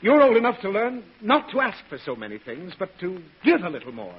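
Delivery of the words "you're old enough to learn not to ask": loud, high, indignant opening; stern scolding. Acted. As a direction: lay down the law to the child. Father scolds child firmly.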